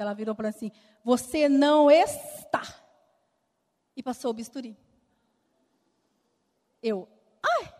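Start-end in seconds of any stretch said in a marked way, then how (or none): 1.0-2.6 slow, loud, clipped denial; irritated doctor. Retold or imitated. Irritated doctor cutting off the patient's complaint, slow and loud. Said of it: "Ela já muito irritada". 7.4-7.7 sharp high yelp of pain; a sudden pained cry as the scalpel cuts. Retold or imitated. Patient feeling the scalpel, sharp high yelp of pain. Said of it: "Eu comecei a chorar de dor".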